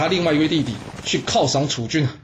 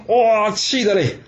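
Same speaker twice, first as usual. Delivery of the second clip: narrator's outrage; 8 semitones higher, slower than usual. A narrator's reading. 楚灵王被当众羞辱.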